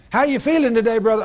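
lightly acted high-pitched greeting; cheery greeter. Churchgoer greeting an aching brother, high and chirpy.

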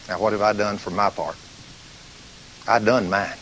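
ordinary delivery

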